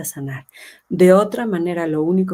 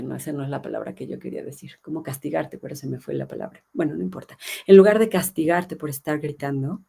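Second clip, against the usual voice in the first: ordinary talk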